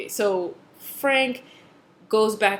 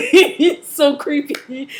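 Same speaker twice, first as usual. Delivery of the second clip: laughing; 6 semitones higher, 10 dB louder, much slower than usual. Real, not acted. Laughing over hot creepy character.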